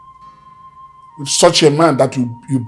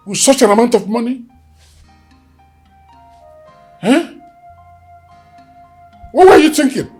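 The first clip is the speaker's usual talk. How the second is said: furious boss demanding answers; angry interrogation; 7 semitones higher than usual. Acted. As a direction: grill the careless men. Boss berating careless staff.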